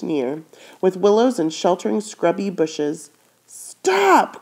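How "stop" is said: sharp high shout of stop; cry out. Acted. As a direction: call the horse to a sudden halt. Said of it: "cried May".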